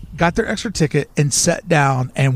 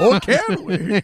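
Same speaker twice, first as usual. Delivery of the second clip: high, drawn-out put-on voice; playing along with the impersonation; Walt Disney; 6 semitones higher, slower than usual. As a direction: stretch it into a comic musing. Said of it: "In that voice".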